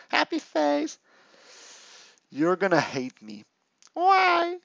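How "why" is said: ordinary talk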